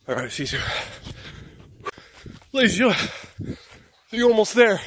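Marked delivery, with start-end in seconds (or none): 2.5-4.8 announcer voice. Pretend. Mock announcer to viewers.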